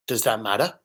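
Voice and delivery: low, subdued defensive retort. Retold or imitated. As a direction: deflect the question quietly, guard up. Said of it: "it was defensive".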